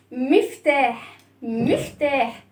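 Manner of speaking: slow, stretched "مفتاح"; careful pronunciation. Shown as a demonstration. Teacher showing the riddle answer to children, slow careful pronunciation. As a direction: draw out both syllables of "مفتاح". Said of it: "شايفين فم عامل إزاي؟".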